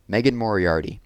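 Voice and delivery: plain reading voice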